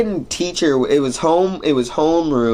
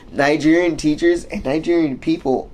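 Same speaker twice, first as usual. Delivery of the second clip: ordinary delivery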